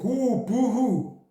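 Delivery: slow, drawn-out sarcastic boo-hoo; mock crying. Vlogger mocks critics threatening to unfollow, with sarcastic fake crying. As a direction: fake sob, dripping with sarcasm. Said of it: "I'm gonna cry".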